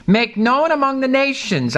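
"nations" lifted high and held; stress on nations. Shown as a demonstration. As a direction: push up and hit "nations". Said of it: "I stress the word nations".

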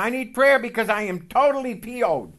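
mock angry complaint from the brother; upset brother. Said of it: "he's quite upset".

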